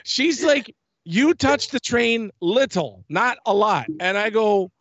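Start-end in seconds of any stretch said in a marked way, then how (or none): 1.0-3.9 much higher, slower put-on voice of a stern ride attendant; imitating the lady. Recounting how the attendant at the ride booth called him back, mimicked high and slow. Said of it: "This is how she sounded".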